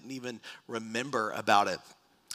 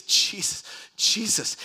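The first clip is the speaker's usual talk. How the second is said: breathy first cry, then higher; retold terror; 7 semitones higher, 4 dB louder, slower than usual. Preacher relives waking from a nightmare, scared plea for help. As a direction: call the name as if barely able to speak. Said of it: "I'm scared to death".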